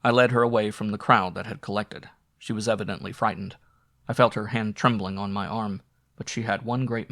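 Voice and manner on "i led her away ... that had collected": ordinary delivery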